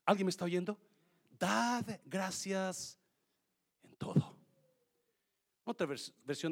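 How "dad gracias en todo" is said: "Dad gracias" slow, "en todo" whispered; slow emphasis. Shown as a demonstration. Pastor to congregation, slow with whispered end. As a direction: draw it out then breathe the end. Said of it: "slow scripture emphasis".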